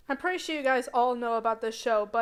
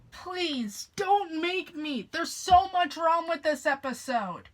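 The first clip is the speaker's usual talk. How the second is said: higher whiny plea; pleading; 6 semitones higher, slower than usual. Acted. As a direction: beg not to be made. Pleading captor.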